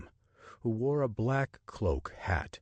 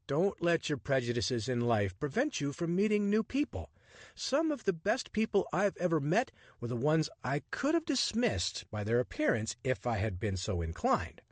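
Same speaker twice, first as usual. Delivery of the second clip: lightly voiced charming rogue, higher and playful; cheeky adventurer; 8 semitones higher than usual. Richard flirting with the woman on the Nile train, playful and cocky. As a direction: tease her with easy confidence.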